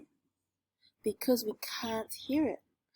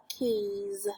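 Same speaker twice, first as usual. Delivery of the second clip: slower; 2 semitones lower, 5 dB quieter than usual. Shown as a demonstration. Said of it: "if you say it slower".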